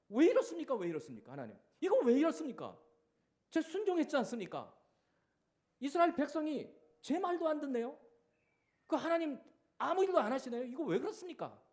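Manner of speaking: loud, high desperate plea; crying out. Retold or imitated. Moses crying out to God, loud and high. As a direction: shout your complaint up to heaven. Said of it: "라고 부르짖는 거예요".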